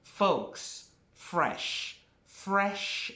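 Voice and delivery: "fresh" punched alone, with pauses round it; emphatic stress on fresh. Shown as a demonstration. Teacher correcting students who want store-bought, stressing "fresh". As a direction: hit each "fresh" firmly, as a correction. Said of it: "I'm emphasizing fresh".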